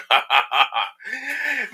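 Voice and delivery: high-pitched giggle that falls away; high laugh. Real, not acted. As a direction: burst into a high laugh at your own joke. Host laughs about muting his guest.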